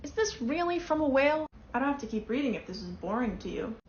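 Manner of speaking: ordinary talk, nothing marked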